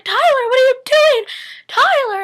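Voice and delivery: high, loud mock shriek of alarm; alarmed mom shout. Tyler's mom sees him chaining himself, high alarmed shout. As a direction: cry out like a panicked mother.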